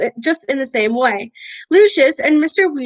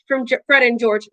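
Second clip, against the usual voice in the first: usual speaking voice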